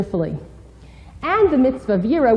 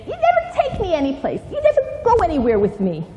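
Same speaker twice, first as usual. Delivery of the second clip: put-on high, whiny child complaining; whiny complaint; 7 semitones higher, faster than usual. Shown as a demonstration. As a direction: nag like a sulky teen. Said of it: "avoid angry tones or criticism".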